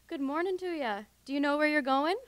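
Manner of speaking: put-on high, loud Irish old woman's greeting; Irish accent. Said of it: "tolerating my horrible accent".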